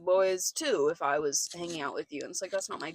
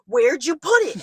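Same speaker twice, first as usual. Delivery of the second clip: loud, higher-pitched stern self-scolding; 6 semitones higher, 11 dB louder, slower than usual. Retold or imitated. Elderly landlord scolding himself over lost thing, imitated stern demand. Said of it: "he started getting stern".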